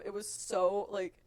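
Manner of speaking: ordinary delivery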